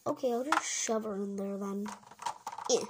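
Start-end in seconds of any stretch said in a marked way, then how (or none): none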